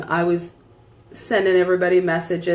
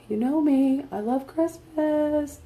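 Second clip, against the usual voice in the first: sing-song delight; 9 semitones higher, 4 dB quieter, slower than usual. Retold or imitated. Pleading to husband.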